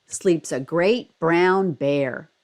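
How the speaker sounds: slow, low storybook line; story reading. A narrator's reading. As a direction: draw it out like a bedtime story.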